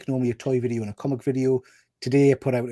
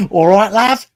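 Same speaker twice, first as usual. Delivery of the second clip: put-on British lad accent, higher and louder; chirpy northern English "all right, lad" greeting; hammed-up English accent; mock Charlie accent; 7 semitones higher, 10 dB louder than usual. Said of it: "Give, give us your best Charlie accent".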